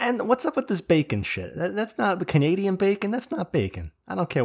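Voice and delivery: high, indignant rant; outraged foodie complaint; mock outrage. Real, not acted. Mock outrage at Canadian bacon.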